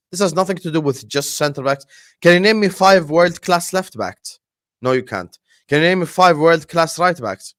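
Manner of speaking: normal talking voice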